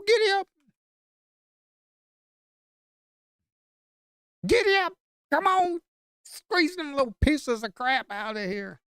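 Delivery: high, loud whooping giddy-up cheers; gleeful high rodeo hollering; excited cheering. Real, not acted. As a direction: ride the trade like a bucking horse, yelling. Cheering a trade that pops.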